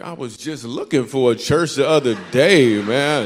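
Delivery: loud, eager comic turnaround; suddenly keen and loud; eager reversal. Retold or imitated. Comedian flipping to impress the pretty fiance, loud eager. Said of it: "I was like".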